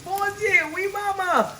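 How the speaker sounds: high shocked cry, laughing; shocked laughing outburst. Real, not acted. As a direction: gasp in disbelief, then laugh. Shocked at rock-hard bakes.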